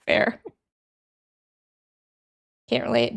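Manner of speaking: normal talking voice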